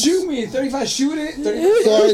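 outraged disbelief. Real, not acted. Outraged at the price.

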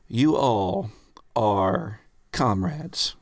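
normal talking voice

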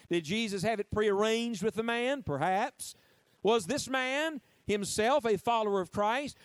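ordinary talk, nothing marked

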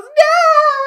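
very high loud shriek of no; dramatic mock scream; mock anguished no. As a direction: wail it like a soap heroine. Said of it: "she just goes".

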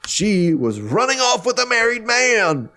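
high-pitched, dramatic accusing yell; mock yelling. Imitating him claiming he's the victim, yelling dramatically. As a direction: cry it out like the wronged party, hammed up. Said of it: "yells about how he's the victim".

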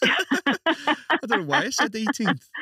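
high, loud, slow words through a real laugh; laughing at himself. Real, not acted. Host laughing at himself for saying eighteenth, laughing through the words. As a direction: laugh it out, embarrassed at the slip.

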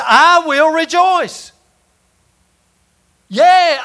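loud, high, slow proclaiming; triumphant shout. Acted. Preacher voicing Paul rejoicing in jail, shouting. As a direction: belt it out like a victory cry.

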